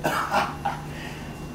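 soft unvoiced cackle under the breath; chuckle, pleased with yourself; quiet mad laugh; breathy gloating laugh. Laughs after the tub line.

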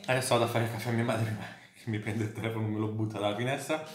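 ordinary delivery

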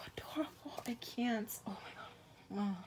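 sighing whispered adoration; soft sighed whisper; breathless whisper. Real, not acted. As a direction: whisper as if overwhelmed by cuteness. Overwhelmed by cuteness.

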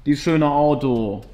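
loud, slow grieving sigh; mourning his beloved car; dismayed lament. Real, not acted.

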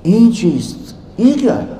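high, stunned question; head in hands, dumbfounded scholar asking; bewildered. Retold or imitated. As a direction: ask it in sheer bewilderment. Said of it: "Maulana रूम अब सर पकड़ के बैठे".

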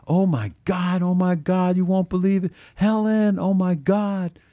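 breathless high excited squeal; screaming excitement. Acted. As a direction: burst in shrieking with news. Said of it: "and screaming".

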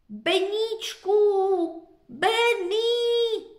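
high, loud fearful call; frightened calling. A narrator's reading. Scared children call their lost dog, high. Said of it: "volali vystrašené děti".

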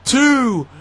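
yelling the near-fall, high and loud; excited shout. Acted. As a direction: explode as the count begins. Match near-fall, commentator erupts.

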